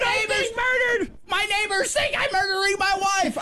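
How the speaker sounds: high, hammed-up panicked thought; imagine the neighbours suspect murder; mock panic. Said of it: "I'm just sitting there thinking".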